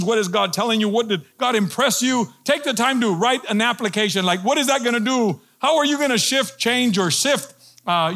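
building sermon fervor; preaching. Acted. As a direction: press the point like a pastor. Preacher explaining method, energized.

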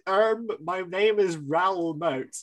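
slow, deadpan mock caller introduction; bland voice. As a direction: introduce yourself as if it were nothing. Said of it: "in this very bland voice".

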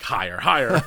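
bossy little cat coaching a dancer; cat dance teacher. Pretend. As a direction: bark orders like a dance coach. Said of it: "Cat's just smacking your leg".

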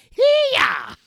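very high, loud shouted yelp; rock yell. Pretend. Host in character letting out a sudden yell. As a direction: let out a stage yelp.

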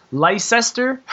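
Leicester as "lie-SESS-ter", high and questioning; mispronounced Leicester. Shown as a demonstration. Host puzzling over the spelling, Leicester mispronounced as "lie-sester". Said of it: "that's how you pronounce".

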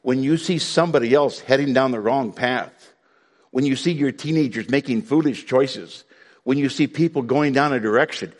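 ordinary talk

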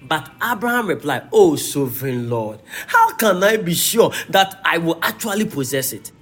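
preacher voicing scripture dialogue with emphasis; scripture reading. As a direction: declaim the verses to a congregation. Reads scripture aloud.

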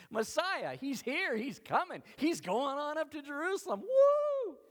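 high, elated crowd cries building to a loud whoop; excited crowd. Acted. Crowd cheering that the Messiah is coming, excited. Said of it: "Everybody's so excited".

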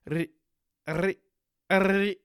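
trilled r on "ri-"; rolled R attempt. Shown as a demonstration. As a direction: try rolling the r at the start of "ri-", in short bursts. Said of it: "like, roll their".

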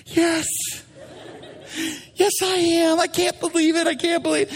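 high, strained acted reply; exasperated admission. Peter admitting he's going fishing, exasperated. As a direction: answer the hecklers in high-pitched exasperation. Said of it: "Peter's like".

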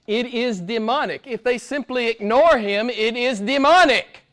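rising, insistent preaching cadence. Real, not acted. Preacher warning congregation, preaching with force. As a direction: hammer each point home. Preaching list of false teachings.

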